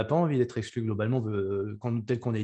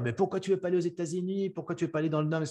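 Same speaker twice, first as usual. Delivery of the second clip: higher-pitched imagined challenge; mock questioning; 6 semitones higher, faster than usual. Host imagining what people could ask the player, mock questioning. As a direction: voice a puzzled outsider's repeated why. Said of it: "on pourrait te dire".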